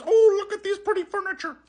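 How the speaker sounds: hammed-up twee museum docent; mocking chintzy tour voice. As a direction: gush over furniture in a mocking high voice. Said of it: "more compelling than this".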